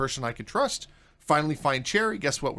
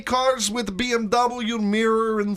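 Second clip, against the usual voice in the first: mock Russian accent; 4 semitones higher than usual. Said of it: "pull out the Russian accent".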